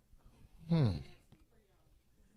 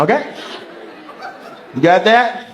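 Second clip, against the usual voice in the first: ordinary talk, nothing marked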